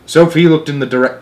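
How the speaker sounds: ordinary delivery, nothing marked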